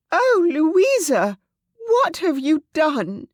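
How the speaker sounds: high, loud, slow anguished question; distraught cry. Acted. Clarice begging Louisa to explain, distraught. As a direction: plead in horror.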